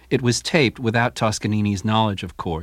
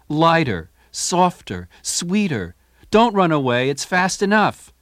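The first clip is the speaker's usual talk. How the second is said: higher, coaxing maestro voice; imitating the conductor; 6 semitones higher, 3 dB louder, slower than usual. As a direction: coax the orchestra gently. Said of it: "he tells the orchestra".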